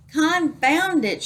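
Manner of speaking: high, loud, drawn-out pirate shout. A narrator's reading. Pirate fed up with the last rascal, shouting. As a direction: explode with pirate exasperation. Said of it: "shouted Pete".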